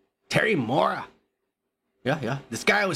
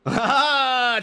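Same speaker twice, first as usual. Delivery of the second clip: loud, higher call; 8 semitones higher, 4 dB louder than usual. Real, not acted. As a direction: shout for your friend. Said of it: "calling out".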